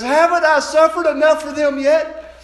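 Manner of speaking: loud, high, rising plea; complaining attitude. Acted. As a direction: cry it out, pleading from the pulpit. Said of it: "the attitude where he says".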